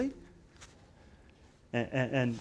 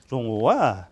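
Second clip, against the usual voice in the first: "Rongoa" said louder with a falling finish; Pat's loud reveal; 2 semitones lower, 8 dB louder than usual. Retold or imitated. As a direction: announce "Rongoa" like a punchline. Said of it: "he goes".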